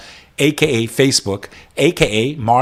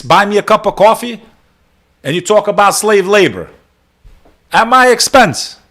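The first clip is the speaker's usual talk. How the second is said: indignant rant; 7 semitones higher, 4 dB louder than usual. Real, not acted. Streamer scolding commenters on stream.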